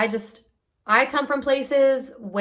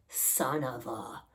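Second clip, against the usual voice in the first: low, slow, very soft swearing; muttered curse; 8 semitones lower, 13 dB quieter, slower than usual. Retold or imitated. Remembering husband's warning while falling, muttering a curse. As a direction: curse under your breath and cut it short. Retold cursing to herself on falling.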